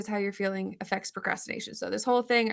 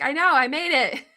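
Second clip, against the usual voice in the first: laughing; 7 semitones higher, 7 dB louder than usual. Retold or imitated. Joking reply to coworker.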